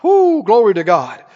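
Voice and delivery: usual speaking voice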